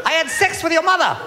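put-on high, happy New Zealand accent, lilting up; two cheery Kiwis, upbeat whatever the news. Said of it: "still sound happy".

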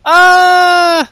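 goons yelling a refusal at Seth; mock shouted refusal. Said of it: "are like".

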